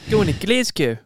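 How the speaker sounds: high, loud mock Kiwi accent; hammed-up Kiwi runner impression; George Beamish impression. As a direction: call it out in a put-on New Zealand accent. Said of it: "do a George Beamish impression of".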